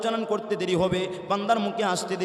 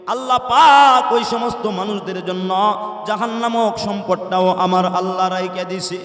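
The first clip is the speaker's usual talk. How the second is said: preaching at full force; 8 dB louder than usual. Real, not acted. Warning of hell.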